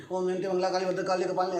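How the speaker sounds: fast, gabbled Om Jayanti Mangla Kali; rushed recitation. Shown as a demonstration. Teacher showing the wrong way: rushed. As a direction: race through the mantra. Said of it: "ऐसे नहीं".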